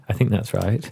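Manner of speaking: ordinary talk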